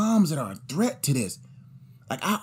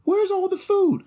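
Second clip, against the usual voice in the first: put-on whiny kid. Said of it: "they're gonna be like".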